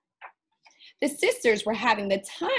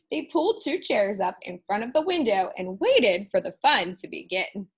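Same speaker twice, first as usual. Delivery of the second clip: plain reading voice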